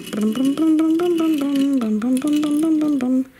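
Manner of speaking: loud bouncy brum engine noise. Pretend. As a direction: zoom the car off to park.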